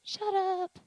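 put-on high-pitched little kid answering; kindergartner voice. Kindergartner telling teacher the S word, high child voice. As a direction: pipe it up like a small child. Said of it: "My kindergartners".

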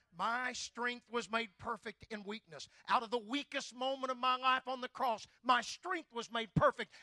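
high, loud proclaiming; full-force preaching. Acted. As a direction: thunder it to the crowd.

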